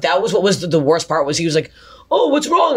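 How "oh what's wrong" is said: high-pitched clueless question; mock-innocent, high voice; oblivious innocence. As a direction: imitate someone blithely unaware. Retold oblivious man asking innocently.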